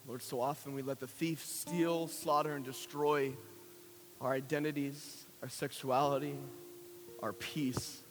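low, soft, measured praying voice; prayer. Real, not acted. Leading listeners in prayer to Lord God, hushed prayer. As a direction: pray quietly, phrase by phrase.

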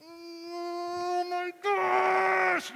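very long, high, building yell; drawn-out mock-furious shout; mad child's outburst. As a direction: imitate a boy bursting with rage, stretching every word. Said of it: "He is so mad".

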